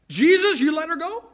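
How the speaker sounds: high, loud outburst of disbelief; astonished protest ending as a question; incredulous onlookers. Acted. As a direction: challenge the judge, pitch leaping up. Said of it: "everybody else is sitting around going".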